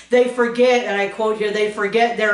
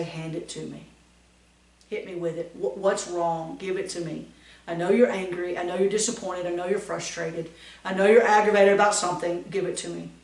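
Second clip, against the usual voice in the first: softer, coaxing the truth out gently; soft reassuring coax; gentle invitation; 3 semitones lower, 4 dB quieter, faster than usual. Shown as a demonstration. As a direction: soothe an upset friend into talking. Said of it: "hold out your hand, and say".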